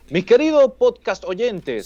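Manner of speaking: high, slow, drawn-out show-host address; mock host greeting. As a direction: playfully greet an audience like a radio host. Coins a host's greeting to listeners.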